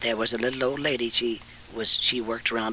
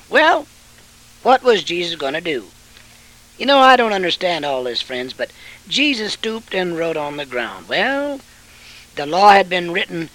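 loud, high fiery sermon voice; preaching at full force; 7 semitones higher, 9 dB louder than usual. Acted. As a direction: thunder it from the pulpit.

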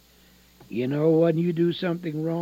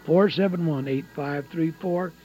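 loud, high-pitched urgent appeal; impassioned preaching; 8 semitones higher, 6 dB louder than usual. Real, not acted. Preacher urging listeners to call in, impassioned preaching. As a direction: press the appeal at full force.

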